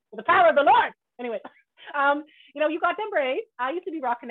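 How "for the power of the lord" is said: loud, high, hammed-up invocation; mock preacher outburst. Host joking about disciplining a guest's kid, mock preaching. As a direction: belt it out like a mock exorcism.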